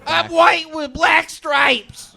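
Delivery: high, slow cartoon voice; cartoon zebra voice. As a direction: stretch it out squeaky. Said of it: "That is the racist zebra".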